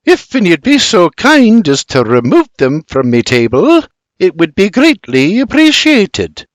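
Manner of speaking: high, tart politeness; stern request. A narrator's reading. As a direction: order disguised as a favour. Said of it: "she barks".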